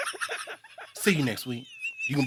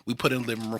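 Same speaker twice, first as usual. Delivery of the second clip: normal talking voice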